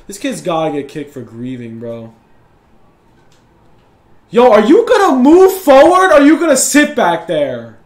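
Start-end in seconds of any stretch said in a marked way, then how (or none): 4.3-7.8 loud, high-pitched irritated demand; angry yelling. Real, not acted. Gamer berating a player for sitting back, angry yell. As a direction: shout it in real annoyance.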